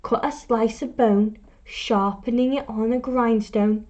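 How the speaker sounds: usual speaking voice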